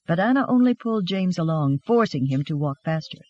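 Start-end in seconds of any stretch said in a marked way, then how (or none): none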